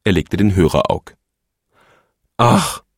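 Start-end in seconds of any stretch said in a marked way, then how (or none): 2.4-2.8 sehnsüchtig seufzendes „Ach“; Seufzer. A narrator's reading. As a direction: seufz es tief. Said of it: "seufzte er". (der Phönix seufzt allein)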